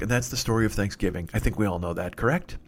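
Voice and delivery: ordinary delivery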